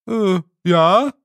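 loud high dopey fairy; dim Feegle. A narrator's reading. Wully asking Rob, loud dim Feegle. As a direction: call out slow-witted.

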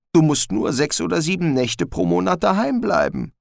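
high, nervous little dog; a jittery mongrel pleading; talking dog voice. Acted. Gespode the dog speaks.

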